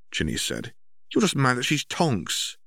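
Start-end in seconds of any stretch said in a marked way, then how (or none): 1.1-2.6 higher, sulky teasing retort; a hint of a sullen younger brother; lightly voiced sulky brother. Brother snipes back at sister, mildly sulky. Ron sulking at Ginny.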